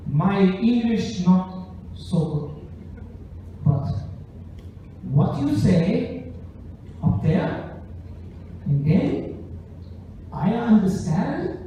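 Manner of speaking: slow, halting broken English of a Japanese businessman; lightly voiced Japanese salaryman, careful and slow; Japanese-accented English. Comic telling his audience about a Japanese businessman fan, slow halting accent. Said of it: "total salary man business dude".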